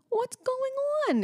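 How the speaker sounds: put-on very high, slow little-girl voice; toddler voice. Mom quoting her daughter at the neighbor's music. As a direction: squeak it like a curious toddler. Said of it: "And she goes".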